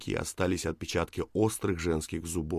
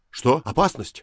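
high, loud startled question; jolted into a sudden shout; alarmed; 7 semitones higher, 9 dB louder than usual. A narrator's reading. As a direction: blurt it out in alarm. Startled man alarmed by her cry.

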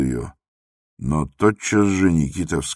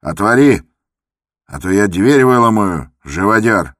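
loud, higher threatening yell; furious shout; 5 semitones higher, 8 dB louder than usual. Acted. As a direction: bellow at the locked door. Иван Дмитрич ломится в дверь. (яростный крик в дверь)

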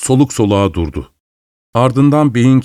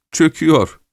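put-on higher-pitched, lightly amused old lady; a touch of a cackle in the voice; chuckling; 6 semitones higher than usual. A narrator's reading. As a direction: say it with a mild, high-pitched chuckle. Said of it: "diye kıkırdadı yaşlı Miss Rashleigh".

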